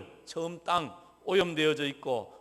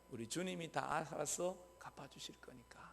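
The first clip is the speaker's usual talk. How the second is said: whispered comfort; 4 semitones lower, 16 dB quieter than usual. Real, not acted. Preacher whispering to the congregation that the Lord will repay. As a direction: breathe it as a secret comfort.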